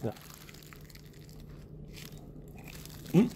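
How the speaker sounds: speaking between bites; talking while chewing. Real, not acted. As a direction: mouth partly full. Seen 5: Central glasses speaker bit hot dog, lips work closed afterward.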